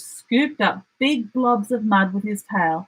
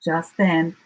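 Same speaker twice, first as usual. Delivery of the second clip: ordinary delivery, nothing marked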